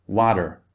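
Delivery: "water" said like "wadder"; "water" with an American d for the t; American flapped T. Shown as a demonstration. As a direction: make the t in "water" a d, American style. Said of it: "In American English".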